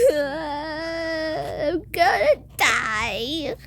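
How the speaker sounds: very slow, drawn-out sobbing wail; hammed-up crying; play-acted despair. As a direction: bawl it in mock terror. Wailing in tornado play.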